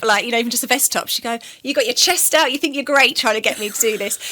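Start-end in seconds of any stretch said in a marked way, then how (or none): 1.6-4.2 teasing nan. Retold or imitated. Nan teasing about low top.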